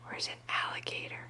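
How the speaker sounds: very soft, hushed whisper. Real, not acted. Parent asks toddler to find alligator, whispering. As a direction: whisper it as a secret search.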